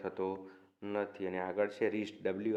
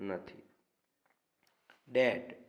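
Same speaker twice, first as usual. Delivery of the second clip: ordinary delivery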